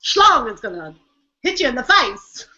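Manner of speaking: put-on a light, jokey stab at a Scottish accent; mock Scottish accent. Topping a friend's joke about kilts, light mock Scottish accent. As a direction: toss off a half-hearted Scottish accent. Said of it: "That was awful".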